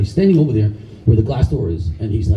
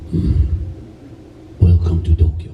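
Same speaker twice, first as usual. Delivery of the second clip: Sosai's deep voice; 8 semitones lower than usual. Retold or imitated. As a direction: rumble it like a grand old master. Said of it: "And he goes".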